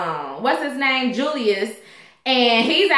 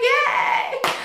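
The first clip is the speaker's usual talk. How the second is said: much higher, squealed little cheer; excited cheer; 13 semitones higher than usual. Retold or imitated. Retelling her delight when the actor favorited her video, high squeal. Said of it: "I was so excited".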